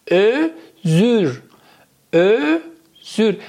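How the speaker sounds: "ازور" very slowly, split as ö... zür; pronunciation demo. Shown as a demonstration. Teacher telling students to watch, "ازور" drawn out slowly. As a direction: break "ازور" into two rounded syllables, very slowly. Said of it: "تلفظشو به من نگاه بکنید".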